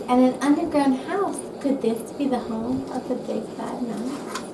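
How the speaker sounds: usual speaking voice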